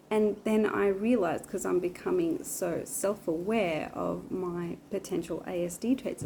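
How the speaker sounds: usual speaking voice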